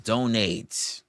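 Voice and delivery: ordinary talk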